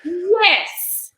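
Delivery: high, loud, drawn-out yes; hammed-up "finally, you get it"; imitating exasperated relief; exaggerated agreement. Retold relief at being understood.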